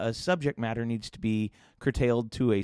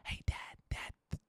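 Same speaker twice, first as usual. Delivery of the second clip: imitating kids' urgent secret whisper; 8 dB quieter than usual. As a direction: whisper to dad, keeping it from mom. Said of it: "come up and whisper about it".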